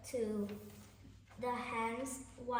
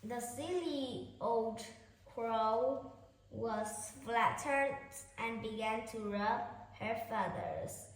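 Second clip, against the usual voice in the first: ordinary delivery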